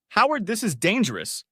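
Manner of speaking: high-pitched urgent warning; alarmed plea. A narrator's reading. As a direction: plead with a dangerous driver. Panicked plea during a car chase.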